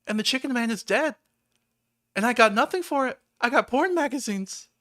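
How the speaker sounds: high and fast, as if he cannot believe it; aghast disbelief. Real, not acted. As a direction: wail it in mock despair. Telling of the chicken man's death.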